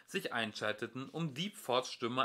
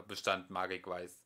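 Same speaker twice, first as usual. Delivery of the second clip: plain reading voice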